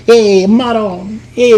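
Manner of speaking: slow, stretched Italian-American "Hey, Maron"; Italian-American exclamation. Shown as a demonstration. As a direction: draw out both words in a hammed-up Italian accent. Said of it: "Hey, like this".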